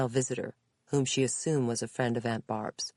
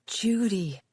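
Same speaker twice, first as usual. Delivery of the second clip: higher, delighted exclamation; happy greeting; 7 semitones higher, 4 dB louder than usual. A narrator's reading. Aunt in bed sees her niece arrive, happy. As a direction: greet her with sudden happiness. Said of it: "her lips parting with happiness".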